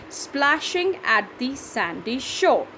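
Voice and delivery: "Splashing at the sandy shore" in slow, even beats; syllable by syllable. Shown as a demonstration. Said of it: "That's seven".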